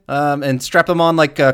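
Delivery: normal talking voice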